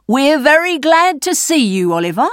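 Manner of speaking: put-on high-pitched welcoming old man; old rogue. A narrator's reading. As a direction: greet the newcomer in a high character voice.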